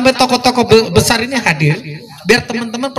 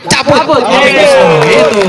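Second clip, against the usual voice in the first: loud rally cry; 3 semitones higher, 5 dB louder, slower than usual. Real, not acted. Rally demand chant.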